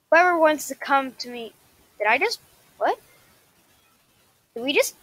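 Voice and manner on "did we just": usual speaking voice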